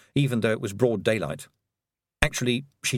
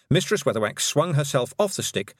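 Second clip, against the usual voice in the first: ordinary delivery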